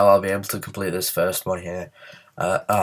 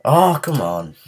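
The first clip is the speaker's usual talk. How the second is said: exasperated groan; 6 semitones higher, 5 dB louder, slower than usual. Real, not acted. Fails again in game.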